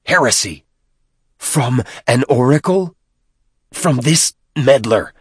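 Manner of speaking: a touch of scornful outrage; spat-out indignation. A narrator's reading. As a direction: accuse with mounting disdain. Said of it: "Tranquility spat, no longer able to keep his peace".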